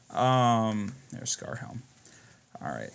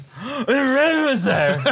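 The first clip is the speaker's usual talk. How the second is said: put-on slow, high, slurred drunken shout; 11 semitones higher, 5 dB louder, much slower than usual. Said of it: "he goes".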